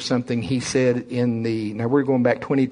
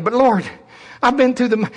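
whiny recruit; 8 semitones higher than usual. Retold or imitated. Complaining recruit praying.

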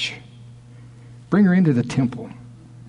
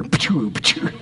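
spray sound effect; 4 semitones lower than usual. Retold or imitated. Imitating spray bottle.

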